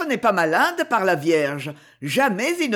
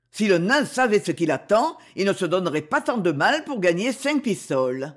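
ordinary delivery, nothing marked

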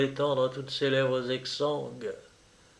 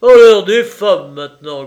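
grumbling; 6 semitones higher, 16 dB louder than usual. Acted. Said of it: "grogna l'aide-major".

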